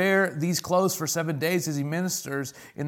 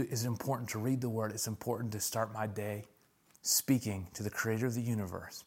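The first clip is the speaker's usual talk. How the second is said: softer, lower, reflective preaching; quiet sermon; 5 semitones lower, 8 dB quieter than usual. Acted. Pastor sharing a morning memory with the church, softly. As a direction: bring it down and speak it gently.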